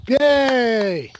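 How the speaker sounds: loud high yay; cheering. Real, not acted. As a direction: celebrate finishing. Celebrating finished promo.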